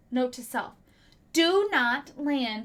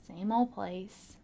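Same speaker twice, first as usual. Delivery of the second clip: whispered, unvoiced aside; 5 semitones lower, 10 dB quieter than usual. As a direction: drop to a bored whisper. Bored aside on nut tree.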